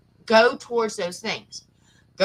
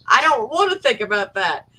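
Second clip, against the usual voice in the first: mock nervous; 7 dB louder than usual. Real, not acted. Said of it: "now I get nervous".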